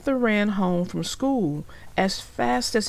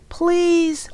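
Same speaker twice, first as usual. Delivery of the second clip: high, louder begging please; boy pleading with his sister; 10 semitones higher, 6 dB louder than usual. Acted. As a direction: beg your sister. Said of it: "Arthur pleaded".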